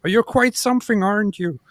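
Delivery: high, loud mock Peterson voice; Peterson impression. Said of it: "Jordan Peterson says".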